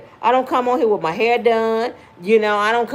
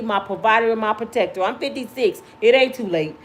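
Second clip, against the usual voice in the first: normal talking voice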